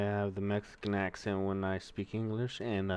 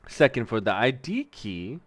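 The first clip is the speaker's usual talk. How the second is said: ordinary delivery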